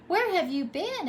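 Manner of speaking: high, loud eager question; excited. A narrator's reading. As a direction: burst out with eagerness. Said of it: "asked the fish excitedly".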